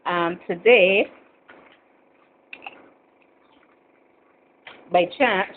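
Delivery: talking with a mouth full of chips; crunching chips. Real, not acted. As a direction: speak while crunching chips. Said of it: "sorry about the crunching, eating some chips".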